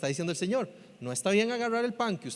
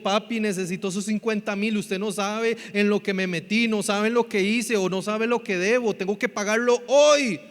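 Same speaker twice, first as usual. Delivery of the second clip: desperate plea; 4 dB louder than usual. Shown as a demonstration. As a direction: beg Dad as if in real trouble. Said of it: "Eso es clamar". (clamar con desesperación)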